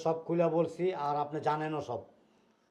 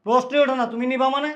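loud, high-pitched indignant refusal; angry refusal; 7 semitones higher, 9 dB louder than usual. Acted. Elder refusing to hand over the child, angry. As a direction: flatly refuse at the top of your voice.

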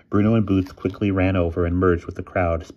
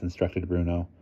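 plain reading voice

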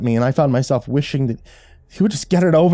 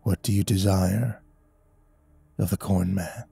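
whispery corn man; 4 semitones lower, 6 dB quieter than usual. A narrator's reading. Corn man granting a boon to frozen child, whispery. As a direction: speak low and hushed, like wind through stalks. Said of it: "His voice sounded like the whisper of wind".

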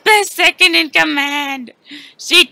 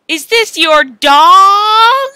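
slow theatrical villain menace; 5 semitones higher, 8 dB louder, much slower than usual. Acted. Threatening hostage dog.

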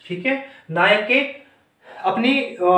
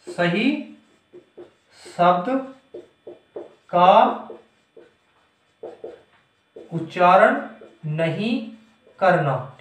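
halting word by word, long gaps; slow dictation; 2 semitones lower, slower than usual. Shown as a demonstration. Teacher dictating notes on pronunciation, slow dictation. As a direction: spell out the phrase slowly for writing down.

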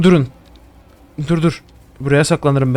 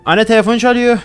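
higher call to mom in another room; 6 semitones higher than usual. Real, not acted. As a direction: call out to mom across the house. Calls mother about ringing phone. (anneye seslenme)

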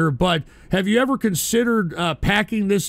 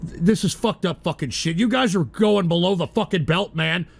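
ordinary delivery

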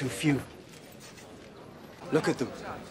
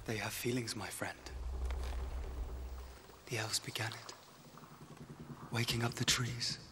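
hushed explanation; 10 dB quieter than usual. Acted. Elf telling the dwarf about living trees, hushed. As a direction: keep it hushed, as if the trees listen. Speaking cautiously in an old forest.

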